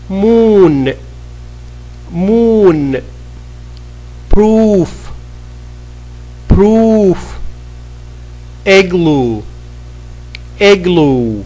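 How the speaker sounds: crisp classroom drill; pronunciation drill. Shown as a demonstration. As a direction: say each word like a teacher for the class to repeat. Arabic-script drill of oo words.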